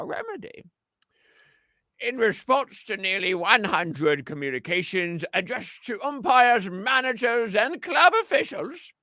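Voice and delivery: put-on high, slow, old-fashioned announcer; old-timey voice. Shown as a demonstration. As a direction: read it like an 1890s orator. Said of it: "I'm gonna put on my best old-timey voice for you".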